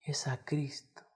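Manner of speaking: breathy whispered repeat of the key phrase; hushed repetition; whispered echo. Acted. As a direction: drop to a whisper for emphasis.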